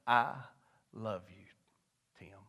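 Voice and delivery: low, hushed, word by word with pauses; imitating God. God speaking to a young man's heart, hushed. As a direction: say it very softly, letting each piece land. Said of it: "echoed Big Daddy Keys just like this".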